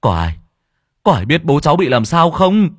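rising to a loud, high cry for help; panicked son shouting for answers; desperate shout. Acted. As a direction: call out frantically to the villagers. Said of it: "Anh Nghĩa gào lên".

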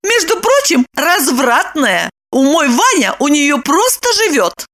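high-pitched, gloating woman's voice; triumphant. A narrator's reading. Lipochka gloats to Roman about his wife, triumphant. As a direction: crow over him in a high voice. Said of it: "торжествуя заявила Липочка".

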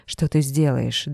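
usual speaking voice

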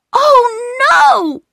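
put-on very high, loud child's wail; dismayed cry. A narrator's reading. Child in the darkness hearing Peter will be taken away, dismayed cry. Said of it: "cried a voice from the darkness".

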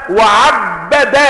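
وعبد very slow, with a held double ba: wa-'abbada; doubled ba. Shown as a demonstration. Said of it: "بتشديد الباء". (وعبّد بتشديد الباء)

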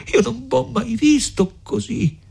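high-pitched tearful dismay; sobbing words. A narrator's reading. Lucia has never seen Renzo so, sobbing words. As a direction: squeeze it out high between acted sobs. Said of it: "a stento tra i singhiozzi".

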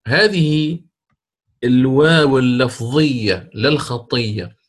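normal talking voice

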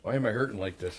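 ordinary talk

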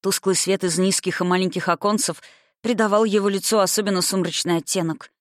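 plain reading voice

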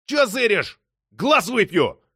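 high, heated threat; furious challenge; menacing snarl. A narrator's reading. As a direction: turn on the bystander in a rage. Wild look, grabs the thief.

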